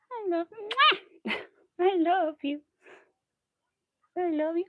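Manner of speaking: high, cooing pet talk; lavish affection on the cat in a lifted voice; doting on the cat. Real, not acted.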